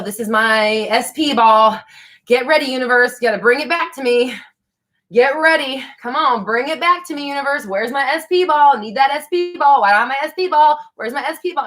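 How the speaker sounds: hammed-up impatient plea, bring it back; mock pleading. Speaker pretending to beg the universe for her ball, mock impatient.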